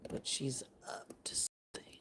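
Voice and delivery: low conspiratorial whisper as a wary bird; suspicious whisper. Pretend. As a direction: whisper your suspicion of the human. Bird's suspicion of the human.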